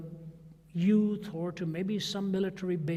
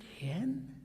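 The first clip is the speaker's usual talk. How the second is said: breathless whisper of a name, rising; hushed stunned question to an old friend on the phone; whispered disbelief; 4 semitones higher, 3 dB quieter than usual. Retold or imitated. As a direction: barely dare to say the name.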